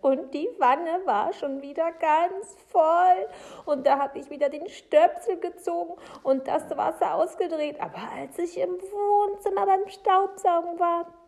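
high, loud, mounting distress; agitated Frau Segebrecht. Acted.

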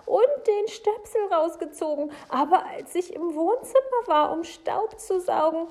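high, loud, insistent account; agitated Frau Segebrecht. Acted. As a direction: press on with the tale, very worked up.

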